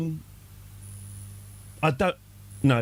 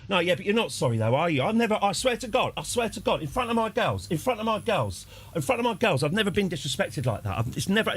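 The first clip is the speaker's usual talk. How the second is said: higher-pitched mock indignant tirade; imitated berating; 5 semitones higher than usual. Dad berating the barman in front of his girls, indignant. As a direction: rant in repeated, affronted bursts. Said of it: "this sort of berating".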